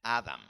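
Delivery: "Adam" stressed on the first syllable; English-style "Adam", closing on the M; English pronunciation. Shown as a demonstration. Teacher repeating the English form for listeners, first-syllable stress on "Adam". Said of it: "En inglés se dice".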